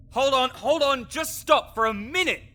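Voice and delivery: urgent pleading. Acted. Necromancer begging the adventurer to stop, urgent. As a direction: halt them before the next blow.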